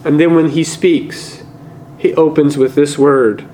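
normal talking voice

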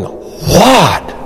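high-pitched, astonished question; acted dumbfounded wondering. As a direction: play a jaw-dropped listener. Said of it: "their jaws are hanging open".

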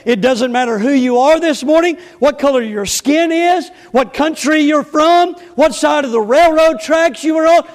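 loud, high-pitched pulpit delivery; full-force preaching. Acted. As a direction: preach it out to the whole church, each phrase driven hard.

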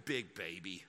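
very low, soft Southern mockery; mock Southern accent. Husband to his wife, low mock-Southern accent. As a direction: drop deep and quiet into a Southern put-on. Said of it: "all of my mockery accents are from the South".

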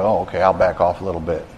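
low, soft, quick retreat; lightly acted, subdued giving-in; mildly deflated. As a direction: back down meekly, imitating a discouraged believer. Voices discouraged believer backing down.

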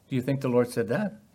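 normal talking voice

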